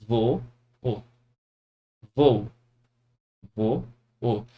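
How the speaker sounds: vo, o split into two syllables. Shown as a demonstration. Teacher to students, splitting voo into syllables. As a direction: separate voo as vo, o. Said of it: "vai ficar assim".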